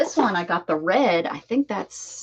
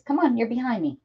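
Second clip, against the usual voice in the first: usual speaking voice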